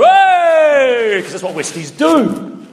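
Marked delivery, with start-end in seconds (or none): 0.0-1.2 high, loud "Hey!" from the crowd; crowd cheer. Retold or imitated. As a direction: whoop it like a rowdy crowd. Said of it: "all the Westies go".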